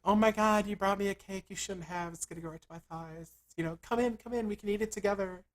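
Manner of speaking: high put-on girl voice; imitated delighted girlfriend. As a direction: gush over a gift in a mock feminine voice.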